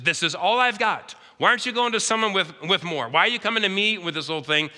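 high, pressed pleading widow. Retold or imitated. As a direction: plead like a widow with nothing left. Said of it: "She says".

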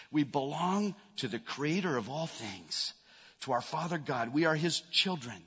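normal talking voice

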